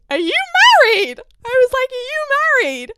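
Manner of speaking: shouting in disbelief at the date; shocked shout. Retold or imitated. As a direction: yell it out in shock. Shocked to learn he's married.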